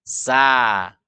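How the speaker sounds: SA light with open "a"; thin sa. Shown as a demonstration. Teacher shows the sound without tongue lift, thin sa.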